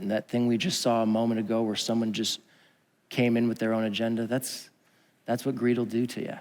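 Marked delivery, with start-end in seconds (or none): none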